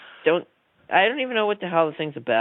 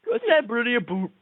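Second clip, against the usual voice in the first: mock Canadian accent; 5 semitones higher, slower than usual. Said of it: "you went Canadian".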